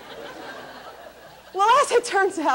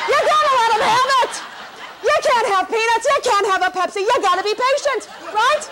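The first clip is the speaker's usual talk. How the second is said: higher, louder sassy cheer; mock sassy cheer; 5 semitones higher, 4 dB louder than usual. Comic cheering on the snippy attendant, higher and louder. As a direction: cheer her on with sass.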